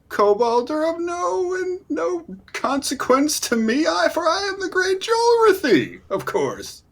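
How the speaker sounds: high, grandiose bragging; boastful goblin hero. Acted. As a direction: brag in a high, lofty goblin voice. Boastful goblin hero dismisses kobolds.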